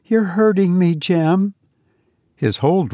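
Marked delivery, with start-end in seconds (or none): 0.0-1.5 put-on higher, startled girl's voice; a high voice, trying to make light of it; young woman in pain. A narrator's reading. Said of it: "caught her breath and tried to laugh".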